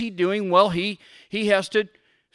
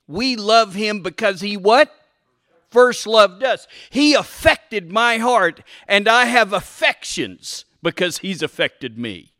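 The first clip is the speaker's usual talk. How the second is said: forceful louder preaching; 3 semitones higher, 5 dB louder than usual. Acted. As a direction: preach it with conviction.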